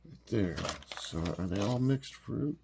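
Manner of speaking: normal talking voice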